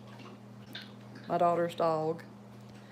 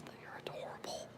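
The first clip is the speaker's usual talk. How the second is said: whisper; 16 dB quieter, faster than usual. Real, not acted. Whispered cooing.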